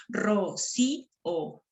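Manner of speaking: ro, si, o as three separate beats; syllable by syllable. Shown as a demonstration. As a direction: pause between ro, si and o. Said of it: "Se silabean y tienen tres sílabas". (ro, si, o silabeado con pausas)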